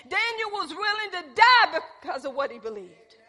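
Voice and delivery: the preacher building to a climax; full-force preaching. Acted. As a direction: preach it with fire.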